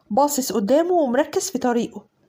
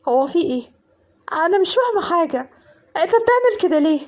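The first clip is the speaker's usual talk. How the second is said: higher, panicked questioning; frightened plea; 4 semitones higher than usual. Acted. Cousin confronting armed Hassan, panicked pleading. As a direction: beg him to explain, scared.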